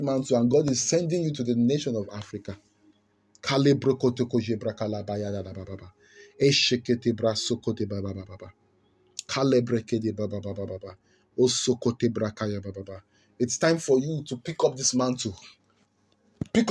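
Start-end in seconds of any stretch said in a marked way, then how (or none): 3.4-13.0 praying in tongues. Real, not acted.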